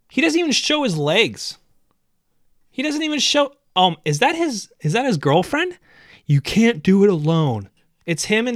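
high, loud outraged rant; incredulous rant at the fitness guy. Real, not acted. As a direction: shout it in disbelief.

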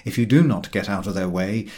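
plain reading voice

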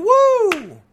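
high, loud "woo"; excited whoop. Real, not acted. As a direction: whoop it up in celebration. Cheering at an imagined scenario.

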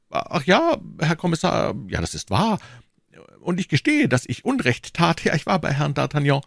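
high, apologetic shopkeeper voice; contrite prisoner. Acted. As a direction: admit it meekly, pitched high. Voices Bonacieux admitting fault.

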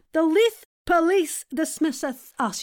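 high Scottish tongue twister; Scottish accent. Shown as a demonstration. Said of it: "in a Scottish accent".

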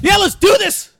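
high, loud charging shout; hollered, high-pitched war cry; rallying battle cry. Acted. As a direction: yell it to fire up your ally. Character answers ally while charging.

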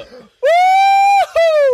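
very high, loud whoop; victory whoop. Real, not acted. Winner whooping after ten out of ten, high whoop. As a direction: whoop in triumph. Celebrating perfect score.

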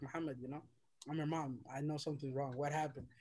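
soft, low motherly concern; gentle coaxing. Retold or imitated. Mom asks her upset son what's wrong, gently. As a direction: coax it out very quietly.

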